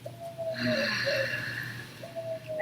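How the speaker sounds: long, soft audible sigh; deep breath. Shown as a demonstration. Yoga teacher demonstrating a big breath, long sigh. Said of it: "take a big deep breath in".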